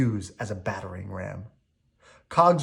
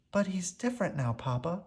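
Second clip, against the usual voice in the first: soft, higher gentle plea; quietly; 7 semitones higher, 7 dB quieter than usual. A narrator's reading. Belle quietly reassuring her father. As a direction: tell him softly he's changed. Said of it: "said Belle quietly".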